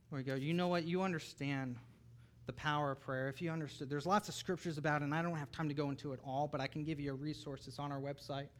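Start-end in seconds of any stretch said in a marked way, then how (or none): none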